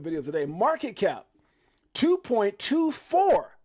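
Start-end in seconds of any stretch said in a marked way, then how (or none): 1.9-3.6 higher, very slow, stretched-out amazement; amazed readout. Real, not acted. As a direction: let the number sink in slowly, stunned. Reading a shocking market cap.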